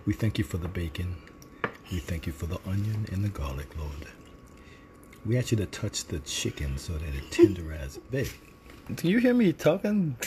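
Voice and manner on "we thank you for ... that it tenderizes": low, soft, solemn grace; mock-serious dinner blessing, hushed and deep; prayer voice. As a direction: pray humbly in a lowered voice. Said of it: "that's my prayer voice".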